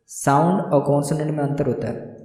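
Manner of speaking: normal talking voice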